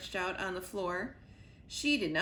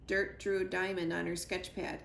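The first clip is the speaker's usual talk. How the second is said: ordinary delivery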